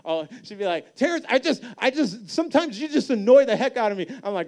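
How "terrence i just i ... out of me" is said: much higher, louder mock wife voice, fed up; exasperated wife. Terrence mimics his wife complaining, high and loud. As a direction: squeal it like the annoyed wife.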